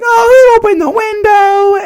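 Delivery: very high, loud theatrical wail; hammed-up shriek. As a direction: cry out in mock alarm. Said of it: "she's like".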